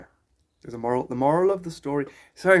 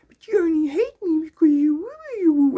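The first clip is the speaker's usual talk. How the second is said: high-pitched put-on pleading voice; playing innocent with haters; 13 semitones higher, 4 dB louder than usual. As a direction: tease the haters in a high, babyish plea. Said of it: "I like to play with them".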